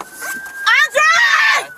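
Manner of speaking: desperate high yell for help; shouting. Real, not acted. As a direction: scream the name across the street. Calls out for help.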